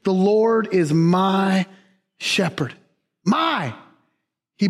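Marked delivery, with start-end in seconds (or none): 0.0-2.8 slow and tender on "The Lord is my shepherd"; slow, personal. Shown as a demonstration. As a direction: intimate slow reading. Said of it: "David makes this thing so personal".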